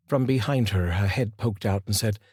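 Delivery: plain reading voice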